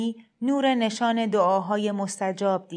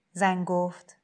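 ordinary delivery

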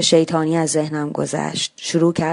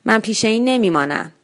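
put-on angry child; 5 semitones higher than usual. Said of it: "با عصبانیت".

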